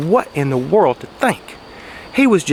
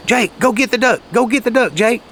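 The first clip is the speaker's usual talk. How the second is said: raised, higher call to a dog; 6 semitones higher than usual. Retold or imitated. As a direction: call Jake out across the blind. Hunter calling his dog.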